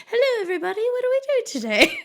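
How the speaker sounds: high, cheery British greeting; mock a chirpy British host saying hello; British accent. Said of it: "are you gonna be like".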